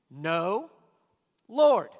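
"Lord" hit loud after a pause; preacher sounding out the oxymoron; stress on Lord. Shown as a demonstration. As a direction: hold after "No", then punch "Lord". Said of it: "The phrase".

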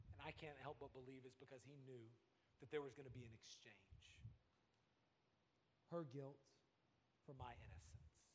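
usual speaking voice

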